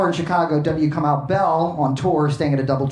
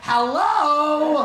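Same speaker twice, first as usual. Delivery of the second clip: high sarcastic shouted hello; 10 semitones higher than usual. Retold or imitated. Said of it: "sarcastically answer the phone".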